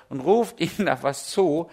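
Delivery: ordinary talk, nothing marked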